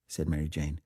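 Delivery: usual speaking voice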